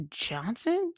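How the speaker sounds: Johnson high and sharply questioning, quiet; doubting your own word; surprised question. Real, not acted. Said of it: "That's not his name".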